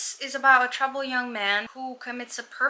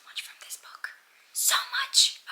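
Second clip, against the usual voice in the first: breathless whisper, rising much higher on so much; excited whisper; 13 semitones higher than usual. Real, not acted. Telling viewers her hopes for Babel, in an eager whisper. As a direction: whisper it like a thrilling secret. Gushing about a five-star prediction.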